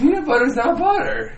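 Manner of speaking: high, laughing insistence; cracking up while arguing; laughing protest. Real, not acted.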